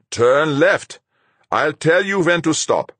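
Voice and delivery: put-on high, loud, accented radio orders; Fuchs, urgent commander. A narrator's reading. Fuchs guiding the pilot in by radar, urgent commander. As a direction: give insistent flight directions.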